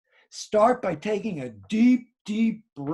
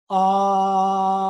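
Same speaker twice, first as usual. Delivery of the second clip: long ah held on the breath, louder; sustained projected ah; 6 dB louder than usual. Shown as a demonstration. Teacher showing projection on breath, held ah. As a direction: project a steady ah for five counts. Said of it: "I will recite the ah sound for five finger counts".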